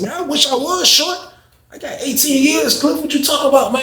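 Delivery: high, strained mock protest; lightly put-on young inmate whining; high whiny complaint. Young inmate protests his long sentence to Cliff, in a high whiny voice.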